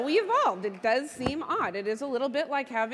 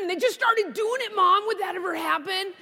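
put-on high, louder pestering kid; insistent child; 5 semitones higher, 5 dB louder than usual. Daughter pressing mom with another what-if, insistent. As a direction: build to an urgent childlike question.

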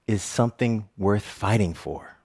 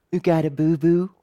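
a hint of a small child asking; little girl's voice; 6 semitones higher than usual. Toddler in the grocery store asking a stranger, lightly voiced. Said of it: "I hear a little voice go".

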